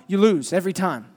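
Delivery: ordinary talk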